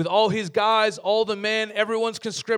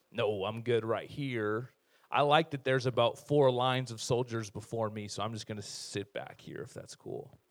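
sheepish soldier; 9 semitones lower, 5 dB quieter than usual. Retold or imitated. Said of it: "everyone's like".

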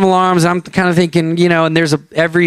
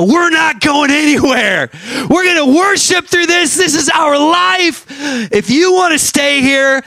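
high, drawn-out defiant mock shout; indignant refusal; 10 semitones higher than usual. Worship leaders refusing to take shelter, high and defiant. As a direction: stand your ground in a high-pitched outburst.

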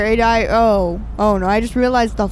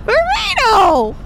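laughing shout; 9 semitones higher, slower than usual. Real, not acted. Laughing exclamation.

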